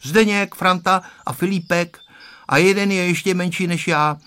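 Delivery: lightly voiced boy, ready answer; unhesitating child's answer. A narrator's reading. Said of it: "odpověděl bez rozmyšlení Honzík".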